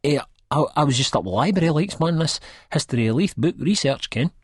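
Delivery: guilty fumbling for an excuse; flustered excuse. A narrator's reading. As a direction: caught out and flustering an excuse to his girlfriend.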